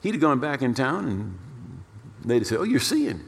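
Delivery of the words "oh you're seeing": surprised exclaim. Retold or imitated. Townspeople greeting the healed man, high amazed cry. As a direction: pitch jumps way up in amazement.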